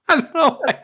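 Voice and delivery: high giggling voice through laughter; can barely talk for laughing; squeaky helpless laughter; laughing hard. Real, not acted. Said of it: "And I laugh every time".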